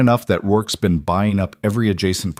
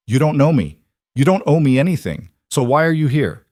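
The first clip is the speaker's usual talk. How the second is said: loud, sharp demand; angry; 6 semitones higher, 4 dB louder than usual. Acted. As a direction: fling it at him. Lydia confronts him.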